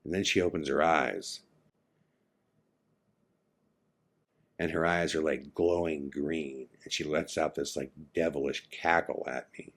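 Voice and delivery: soft, low horror-story reveal; hushed, ominous. Retold or imitated. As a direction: let the nightmare unfold quietly, with long pauses. Friend reveals the nightmare's horror.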